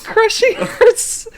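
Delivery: high laughing break while reading; laughing fit. Real, not acted. Reading the smutty line aloud and losing it, laughing. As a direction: try to read but collapse into laughter. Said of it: "I wasn't prepared for sagging".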